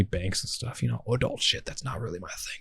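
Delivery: a secret little aside, whispered. Real, not acted. Aside about adult things.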